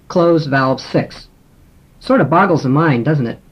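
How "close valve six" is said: very slow, spaced words; slow spoken command. Shown as a demonstration. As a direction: give the command word by word. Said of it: "we could command with".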